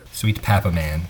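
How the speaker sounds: low-pitched "Papa Man" with flat "a"; deadpan low repeat, "Man" not "mahn"; corrected pronunciation. Shown as a demonstration. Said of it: "It says man".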